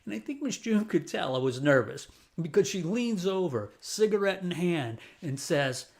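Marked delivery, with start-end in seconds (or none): none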